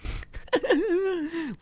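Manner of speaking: long, high wheezy giggle; high giggling fit; real laughter. Real, not acted. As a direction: crack up helplessly. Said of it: "Why am I laughing?".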